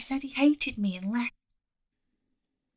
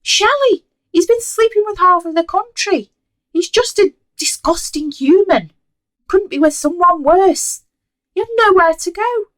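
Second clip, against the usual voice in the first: high, loud outraged mother; 11 semitones higher, 14 dB louder than usual. Acted. Mother scolding her daughter about the cheating boyfriend, outraged and shrill. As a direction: rail at her in horror.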